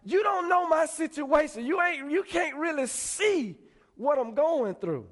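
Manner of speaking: high, loud, drawn-out objection; distressed protest. Acted. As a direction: push back as someone overwhelmed. Troubled believer rejects friend's comfort.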